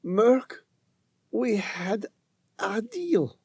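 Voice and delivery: high, faint, pleading woman's voice; weak pleading woman. Acted. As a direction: plead weakly, near the end.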